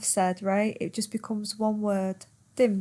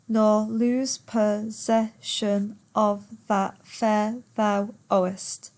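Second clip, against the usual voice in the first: slow, syllable-by-syllable verse reading; per suku kata; slower than usual. Shown as a demonstration. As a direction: count out the line, one beat per syllable. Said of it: "Ten syllables".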